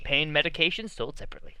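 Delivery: quick quiet legal disclaimer; fast disclaimer. Acted. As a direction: rattle it off like fine print.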